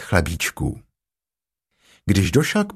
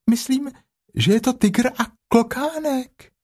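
much higher, small timid Piglet; little Piglet voice; 14 semitones higher than usual. A narrator's reading. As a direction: squeak it like a tiny pig. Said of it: "zvolalo prasátko". (vysoký ustrašený hlásek prasátka)

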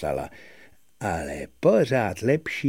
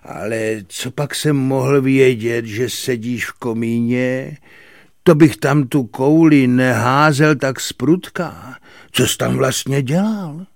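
raised voice, defending himself; flustered, louder self-justification; apologetic protest; 4 semitones higher, 7 dB louder than usual. A narrator's reading. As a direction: excuse yourself with rising urgency. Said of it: "omlouval se Kominík".

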